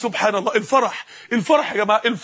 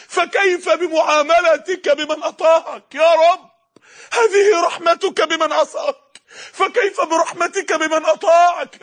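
high, drawn-out cry of awe, as a man retold; retold anguished cry to God; 4 semitones higher than usual. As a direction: act out his stunned plea, crying out slowly to the Lord. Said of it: "وهو يصرخ ويقول".

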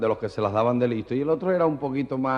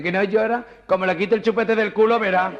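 much higher, louder sassy retort; sassy punchline; 10 semitones higher, 5 dB louder than usual. Acted. Nurse snaps back about the pacifier, high and loud. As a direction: deliver the punchline high and cheeky.

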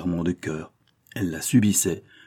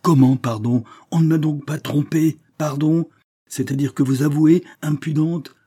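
loud, high-pitched indignation; outraged tirade; 9 semitones higher, 7 dB louder than usual. A narrator's reading. Countess erupts at her daughter's confession, outraged. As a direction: explode in scandalised fury.

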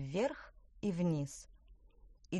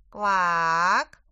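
a huge, much louder croak; booming croak; 10 dB louder than usual. A narrator's reading. The giant frog king croaks at them, booming loud. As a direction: let out a monstrous frog croak.

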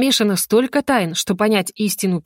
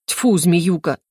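ordinary delivery, nothing marked

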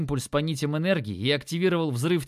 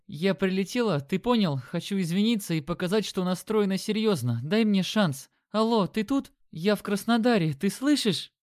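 higher, worried but resolute woman; urgent girlfriend on the phone; anxious woman; 6 semitones higher than usual. A narrator's reading. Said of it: "Голос Лены был взволнованным, но решительным". (взволнованный, но решительный женский голос)

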